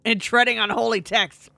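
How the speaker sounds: alarmed reading. Acted. Player reading the crew's sacrilege, loud alarm. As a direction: blurt the line out in alarm.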